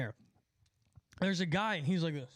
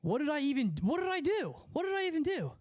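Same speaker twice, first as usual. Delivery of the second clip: much higher, startled protest; panicked, what did I do; alarmed disbelief; 8 semitones higher, faster than usual. Real, not acted. Stream warned of suspension.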